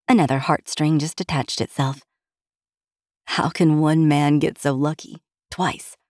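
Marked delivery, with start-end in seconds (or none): none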